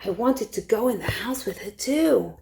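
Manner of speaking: usual speaking voice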